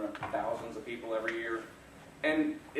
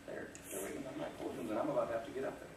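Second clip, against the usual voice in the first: nervous whisper; 6 dB quieter, faster than usual. Retold or imitated. Nervous thought looking at crowd.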